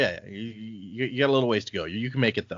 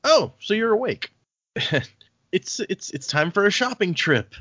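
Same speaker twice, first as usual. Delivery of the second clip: higher, prim voice; lightly voiced prim tutor; mock Tindwyl; 9 semitones higher than usual. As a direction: breezily announce errands. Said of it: "Tindwyl's like".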